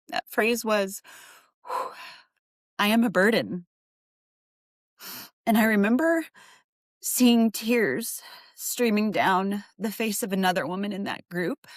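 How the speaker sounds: real emotion catching, pitch lifted; choked up. Real, not acted. Recalling the women's group, fighting back tears. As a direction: voice welling with tears mid-story. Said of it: "ooh, I didn't realize I'd get emotional".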